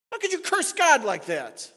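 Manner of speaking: rear back in outrage; shocked rebuke. Retold or imitated. Preacher voicing believers rebuking a blasphemer, loud outrage. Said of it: "We'd rear back and say".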